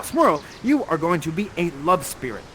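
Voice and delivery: ordinary talk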